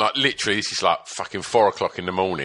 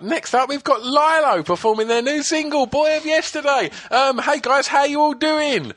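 put-on kids' TV presenter. Breakfast show host introducing the band, chirpy presenter voice. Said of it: "And it was like".

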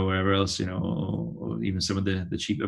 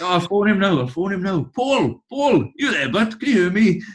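imitating a Welsh Valleys dad calling down the phone, much higher and louder; hammed-up Valleys accent, hollering into a phone; 12 semitones higher, 6 dB louder than usual. Dad rings his wrestler friend, calling loudly in a Valleys accent. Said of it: "Welsh accent or, you know, a Valleys accent".

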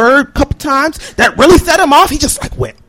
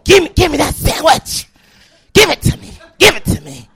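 crazed man. Retold or imitated. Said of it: "the crazed look in his eyes".

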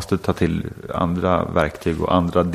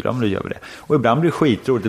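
ordinary delivery

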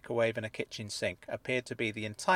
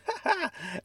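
sudden high squawk of laughter. A narrator's reading. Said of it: "I squawked with laughter".

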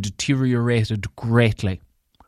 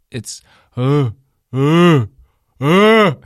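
big sneeze; 3 semitones higher, 8 dB louder, much slower than usual. Real, not acted. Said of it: "There's no achoo here".

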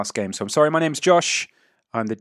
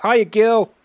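high, loud call from afar; shouted greeting; 14 semitones higher, 10 dB louder than usual. Acted. Tavern keeper waving as you walk past, shouted greeting. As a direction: shout a hello across to a passer-by. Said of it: "and she shouts".

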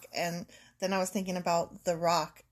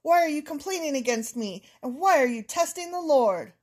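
loud, higher scolding; angry Moses; 4 semitones higher, 5 dB louder than usual. Acted. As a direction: snap at the complainers. Reading Moses angry reply.

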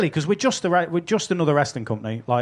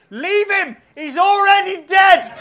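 very high, anguished mock shout; slower than usual. Wrestler begging attacker to stop slapping his partner, shouting. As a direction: plead at the top of your range. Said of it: "Chief Deputy Dunn is shouting".

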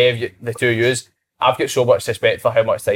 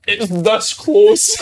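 high, very slow strained imitation; mock urgency; 7 semitones higher, much slower than usual. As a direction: drag it out as if barely holding on. Acting out Cal's urgent plight.

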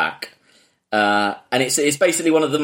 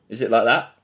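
usual speaking voice

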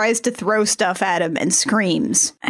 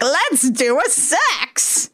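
higher, very slow, silly creature voice; goofy imp voice; 5 semitones higher, much slower than usual. Retold or imitated. Podcaster imitates the imp propositioning the hag, goofy creature voice. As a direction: drag it out like an eager little imp. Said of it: "the imp is like".

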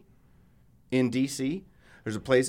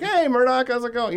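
friendly greeting; 8 semitones higher than usual. Pretend. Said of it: "I was like".

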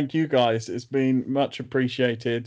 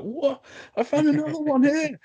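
put-on high, amazed kid digging up a toy; much higher, thrilled discovery; delighted child shouting his find; excited kid; 3 dB louder than usual. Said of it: "You'll be like".

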